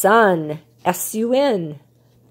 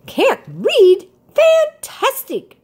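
delighted bird; 14 semitones higher, 5 dB louder, slower than usual. A narrator's reading. Bird thrilled at new pupil.

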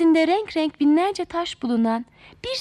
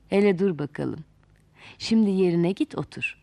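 put-on a wise old man, lower and calm; 7 semitones lower than usual. A narrator's reading. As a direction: calmly settle the angry girl. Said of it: "demiş sakin sakin".

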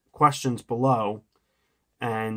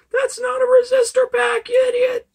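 squeaky high, loud mock commenter; 8 dB louder than usual. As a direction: shriek it like a snotty online troll. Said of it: "Someone is probably typing in the comments".

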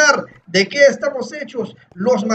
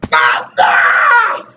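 loud, high drawn-out shout; exultant yell; 9 semitones higher, 4 dB louder than usual. Acted. Gamer hyping himself up as parkour master, exultant yell. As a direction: belt it out at the top. Mock fans' praise ends in yell.

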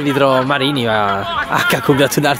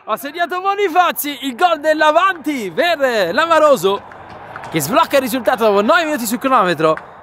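breathless italian goal commentary; 9 semitones higher than usual. Real, not acted. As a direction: shout the goal, drawing it out.

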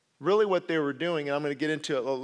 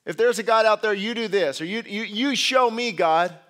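louder, higher mocking challenge; atheist taunt; 5 semitones higher, 7 dB louder than usual. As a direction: sneer at believers as a skeptic. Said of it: "they'll say".

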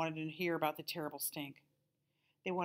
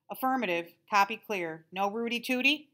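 higher, louder military radio patter; radio-talk joker; 4 semitones higher, 7 dB louder than usual. A narrator's reading. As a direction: play the wisecracking intercom guy. Intercom guy joking radio talk.

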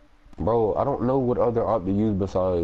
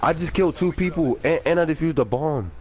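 excited; 7 semitones higher than usual. Real, not acted. Bragging after clutch play.

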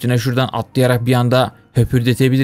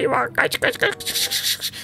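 high breathless whisper, slow and drawn out; high whispered mock panic while fleeing; panicked whisper; 7 dB quieter, much slower than usual. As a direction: hiss a frantic run-run-run. Fleeing the bear.

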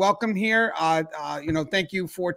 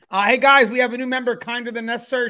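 calling out; 4 semitones higher, 6 dB louder than usual. Real, not acted. Host calling out to audience.

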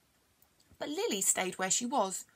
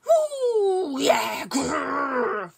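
high loud playful pounce with a growl; excited yelp; 6 semitones higher, 10 dB louder, much slower than usual. Acted. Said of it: "yelped Lily as she pounced".

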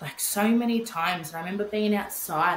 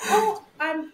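startled gasp, pitched much higher; retold freak-out; 10 semitones higher, 4 dB louder than usual. Mother caught breastfeeding by nurse, startled higher yelp. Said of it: "and I freaked".

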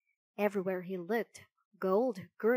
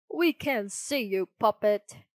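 louder, menacing snarl; 7 dB louder than usual. A narrator's reading. Murderer to hiding Pinocchio, snarling. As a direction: threaten with a raised, snarled voice. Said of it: "snarled one of the murderers".

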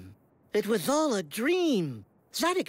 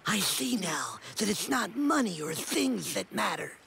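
ordinary delivery

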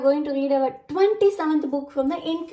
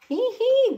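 high loud hee hee giggle; 12 semitones higher, 5 dB louder than usual. Acted. Peppa giggling with friends, high giggle. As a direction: giggle like a small piglet. Children laughing on roundabout.

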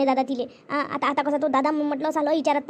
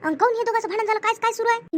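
higher, anxious questioning voice; worried elder; 6 semitones higher than usual. Acted. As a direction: ask high and urgent what has happened. Elder asking what the quarrel is. (उंच, काळजीने विचारणारा आवाज)

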